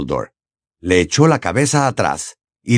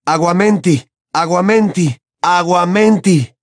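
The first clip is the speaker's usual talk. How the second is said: higher, louder, slowing repeated incantation; desperate spell; 8 semitones higher, 3 dB louder than usual. Acted. Harry casting over a dying Dumbledore, desperate. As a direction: cast again and again in despair.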